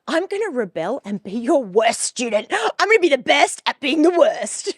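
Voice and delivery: high, loud laughing vow of rebellion; laughing defiance. Retold or imitated. Teen to her teacher, laughing defiant boast. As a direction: cackle through the rebel vow.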